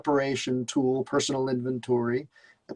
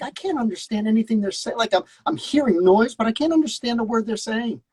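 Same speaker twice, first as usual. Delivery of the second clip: higher-pitched mock exasperation; exasperated complaint; 8 semitones higher than usual. Student retelling his complaint to wife, exasperated. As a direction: retell a frustrated viewer's gripe, pitched up.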